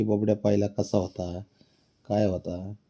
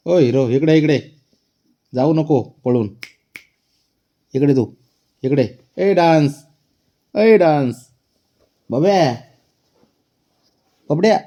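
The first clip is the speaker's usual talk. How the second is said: loud, high repeated calls; calling the cat; 5 semitones higher, 6 dB louder than usual. Real, not acted. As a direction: coax the cat back, insistent.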